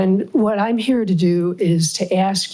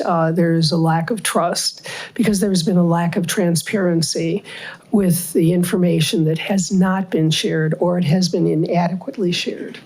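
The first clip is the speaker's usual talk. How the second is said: ordinary talk, nothing marked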